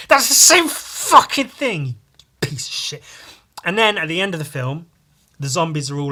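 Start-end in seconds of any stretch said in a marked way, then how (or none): 0.1-3.0 angry outburst. Real, not acted. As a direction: spit it out in disbelief. Ranting at film character's line.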